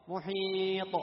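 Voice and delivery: loud "محيط" with long ee and bounced final t; recited "محيط", echoed ending; qalqala on taa. Shown as a demonstration. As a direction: stretch "محيط", then pop the heavy t. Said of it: "فرض القلقلة".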